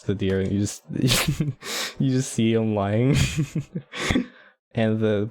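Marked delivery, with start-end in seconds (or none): 0.9-1.9 high breathy giggle; laughing. Real, not acted. Laughing at emperor dragged story. 3.2-4.2 high breathy giggle; laughing. Real, not acted. Laughing at emperor dragged story.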